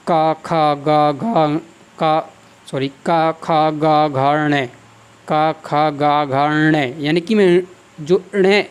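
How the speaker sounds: का, खा, गा, घा, ण recited in a measured row; syllable drill. Shown as a demonstration. Teacher reciting the ट-row to show the nasal at its end. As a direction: chant the row slowly, landing on ण. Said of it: "जैसे".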